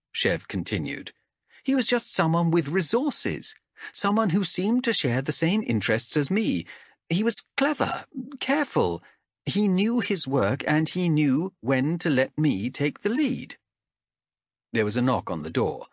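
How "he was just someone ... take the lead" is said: lightly voiced guarded young ranger; a hint of a defensive wanderer; young woman character voice. Chev voiced by narrator.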